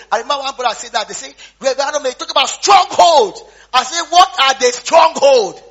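loud, high fervent preaching; preaching at full force. Acted. Preacher recounts Bible argument, preaching loud. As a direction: preach it hard to the crowd.

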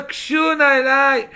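ordinary talk

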